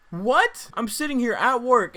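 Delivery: loud high disbelieving what; shocked. Retold or imitated. Narrator reacting to the weed offer, loud shocked disbelief. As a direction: blurt it out in sheer disbelief.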